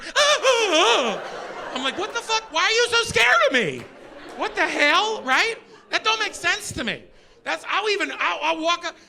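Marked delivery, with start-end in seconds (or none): 0.0-1.7 high, loud frightened yelps; startled shrieks. Retold or imitated. Wife scared again. 2.0-5.2 exasperated disbelief. Retold or imitated. Exasperated at scared wife.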